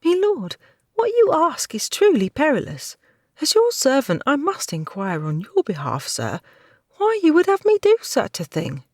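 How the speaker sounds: put-on high, pleading servant girl; the frightened maid begs her master, high and pleading; timid maidservant. Maidservant to her lord, high timid voice.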